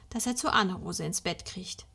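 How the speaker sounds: plain reading voice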